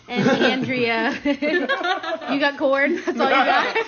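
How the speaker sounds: laughing while asking; giggling through the teasing question. Real, not acted. Teasing friend about her corn.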